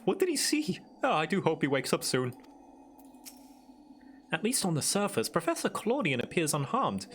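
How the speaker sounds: high, earnest voiced character; voiced game character, concerned. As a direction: worry aloud as the gentle healer. Voicing character dialogue.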